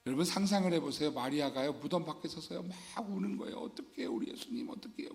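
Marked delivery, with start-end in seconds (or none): 3.6-5.1 hushed, breathy acted weeping at the tomb; preacher acting out Mary weeping softly at the tomb; whispered mock sobbing. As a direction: whisper it like a grieving woman crying. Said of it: "저 연기 잘하나요?".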